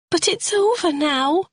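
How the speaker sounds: a frightened suspect begging to be believed; Carol, distressed woman. A narrator's reading. Suspect pleads her story to the sergeant, high and pleading.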